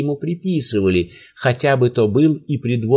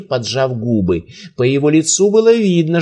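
usual speaking voice

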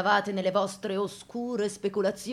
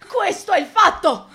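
loud, higher final blow; angry; 9 semitones higher, 16 dB louder than usual. Acted. Priest closing his accusation, angry. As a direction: slam the verdict down.

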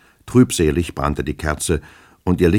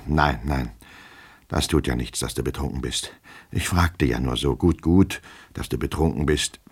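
placating; 2 semitones lower, 3 dB quieter than usual. Acted. Master calming his offended drunken servant, placating. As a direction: back off and soothe him.

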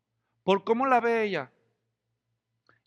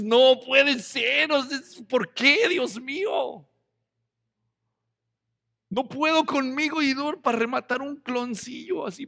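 slow, loud, anguished cry at the ceiling; dramatic lament; 5 semitones higher, 5 dB louder than usual. Pretend. Self-loathing man at the mirror, slow loud hammed-up lament. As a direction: wail it out like a hammed-up tragedy.

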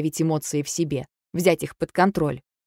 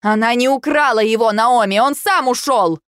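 much louder, higher yell; shout back; 6 semitones higher, 6 dB louder than usual. A narrator's reading. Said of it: "кричит в ответ София".

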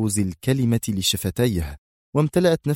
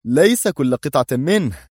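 higher, eager correction; enthusiastic; 5 semitones higher, 4 dB louder than usual. A narrator's reading. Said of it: "وهو يقول في حماس".

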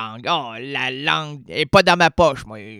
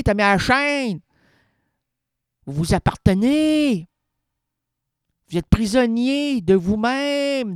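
mock sermon; 7 semitones higher than usual. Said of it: "mock preachy speech on marriage".

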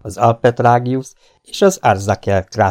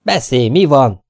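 a loud, slow, impatient demand over the radio; 6 semitones higher, 7 dB louder, slower than usual. A narrator's reading. André, over the loudspeaker, impatiently demands news from the crew. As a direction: press for an answer, losing patience. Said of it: "türelmetlenkedett André".

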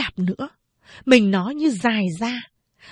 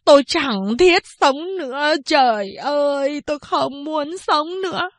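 higher, louder pretend cries of despair; mock wailing cry; 5 semitones higher, 3 dB louder than usual. Boy hopping in the noose, crying out higher and louder in mock despair. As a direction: yelp each line out as he jumps in the noose. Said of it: "mỗi lần nhảy một lần kêu lên".

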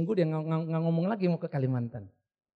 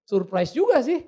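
ordinary delivery

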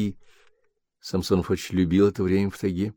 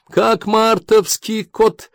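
much higher, slow, sneering; scornful wife; 13 semitones higher, 4 dB louder, much slower than usual. Acted. Wife sneering at husband, high and slow. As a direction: drag out the insult.

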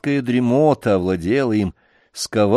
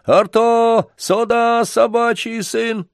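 very high, cross calling; angry old-man falsetto; 13 semitones higher, 3 dB louder, slower than usual. A narrator's reading. Grandfather shouting for the dog after sleep, angry falsetto. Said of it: "сердитым, сиплым от сна старческим фальцетом".

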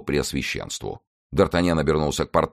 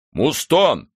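high, loud call to a servant; 10 semitones higher, 6 dB louder than usual. A narrator's reading. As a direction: shout the name to summon him. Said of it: "крикнул Портос". (громкий окрик слуге)

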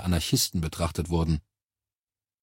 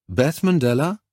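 higher, rising mocking question; spöttische Nachfrage; 7 semitones higher, 3 dB louder than usual. A narrator's reading. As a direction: query the name as if it were a joke. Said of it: "Ihr Lächeln wirkte mit einem Mal spöttisch". (spöttisch-ungläubig nachgefragt)

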